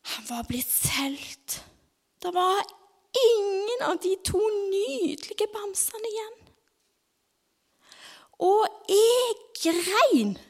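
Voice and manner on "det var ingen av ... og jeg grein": high, wailing mock sob of a little girl; imitating child crying. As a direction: act out the child's crying, hammed-up. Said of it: "Ja, jeg grein skikkelig mye".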